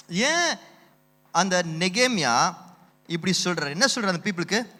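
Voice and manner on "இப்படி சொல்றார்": usual speaking voice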